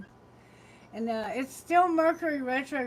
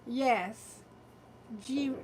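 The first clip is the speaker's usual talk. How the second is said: ordinary talk, nothing marked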